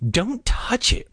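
high-pitched warning. A narrator's reading. Margaret cautioning the others at the booth, warning. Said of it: "Margaret warned".

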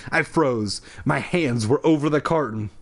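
normal talking voice

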